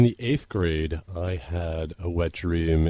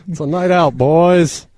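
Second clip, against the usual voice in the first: rowdy bachelor party bro; 9 semitones higher, 11 dB louder, slower than usual. Retold or imitated. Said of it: "where they were like".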